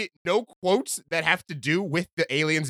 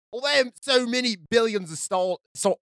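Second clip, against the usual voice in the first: wobbly mock-British reading; attempted British accent; 2 semitones higher than usual. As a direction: have a stumbling go at a British accent. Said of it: "Hold on, pick an accent".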